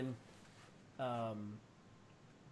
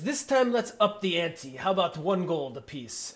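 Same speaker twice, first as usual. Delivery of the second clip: higher, louder giant challenge; giant raising stakes; 4 semitones higher, 7 dB louder than usual. Acted. Giant upping the ante, louder challenge. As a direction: dare him with a grin. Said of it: "He goes".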